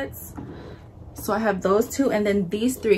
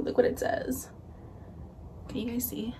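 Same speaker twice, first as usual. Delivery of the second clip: ordinary delivery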